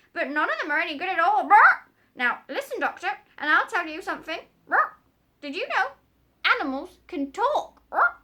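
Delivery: very high, loud parrot squawk. Acted. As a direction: screech like a bossy parrot.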